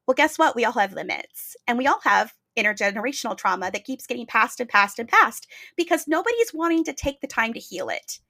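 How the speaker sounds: ordinary talk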